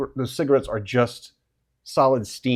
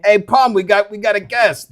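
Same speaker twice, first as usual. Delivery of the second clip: higher, louder creature calling to a friend; excited steam creature; 7 semitones higher, 8 dB louder than usual. Acted. Steam creature telling Pom about the guest, higher and louder. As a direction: announce the guest, pitched up.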